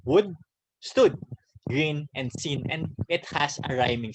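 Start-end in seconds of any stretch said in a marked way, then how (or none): 0.1-1.2 "stood" punched louder and higher; emphasising the rhyme. Shown as a demonstration. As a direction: bring out the rhyming ends. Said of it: "notice that there's a repetition of sound".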